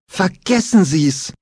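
slow, high, forced through tension; pressed out. A narrator's reading. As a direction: squeeze it out, barely holding back. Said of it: "presste Besmer hervor".

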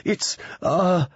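high panicked stall; hyperventilating. A narrator's reading. Alan cannot get the news out, high and panicky. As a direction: falter high, unable to finish.

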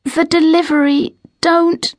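high, strained, halting words; faltering plea from someone near fainting; barely able to speak. A narrator's reading. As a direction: struggle to get each word out, pitched high. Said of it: "Lily can just say".

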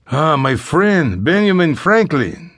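put-on high, slow, savoring Spanish accent; Spanish-accented English. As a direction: greet the money slowly in a Spanish accent. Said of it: "He addressed the fathers in accented English".

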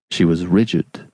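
ordinary delivery, nothing marked

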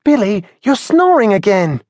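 young sparrow Henry, voiced high; a high-pitched young bird chiding his friend; Henry nudging his dozing friend awake, in a high young bird voice; Henry's voice. Henry wakes dozing Billy.

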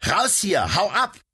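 high, forceful shout to drive away. Acted. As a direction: yell to chase the animal out. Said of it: "schrie sie".

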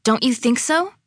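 high-pitched insistent question; pressing. A narrator's reading. Claire presses Lily to agree, insistent. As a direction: push for agreement. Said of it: "pressed Claire".

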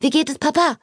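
high, worried sister; anxious Elisabeth. A narrator's reading. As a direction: ask it high with concern. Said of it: "fragte Elisabeth".

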